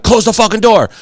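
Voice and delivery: high-pitched exasperated yell, acted out; retold angry order. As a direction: replay losing control at him. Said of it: "I'm being out of control".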